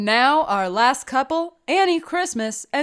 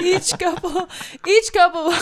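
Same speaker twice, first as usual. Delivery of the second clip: cracking up mid-sentence; giggling through the words; laughing; 6 semitones higher than usual. Real, not acted. Narrator breaking up laughing.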